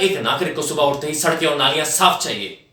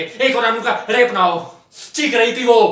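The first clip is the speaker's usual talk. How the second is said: raised, higher voice full of outrage; building louder into raw indignation; anguished outburst; 6 semitones higher, 4 dB louder than usual. Acted. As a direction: cry out in pained fury.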